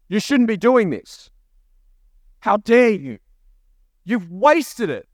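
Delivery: mock scolding. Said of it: "she's coming against religious spirits now".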